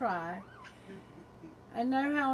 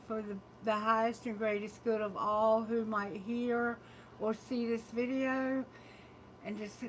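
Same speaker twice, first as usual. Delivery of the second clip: subdued, lower-pitched invocation; opening prayer; 3 semitones lower, 3 dB quieter than usual. Real, not acted. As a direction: pray quietly and evenly, fading softer toward the end. Prays before the reading.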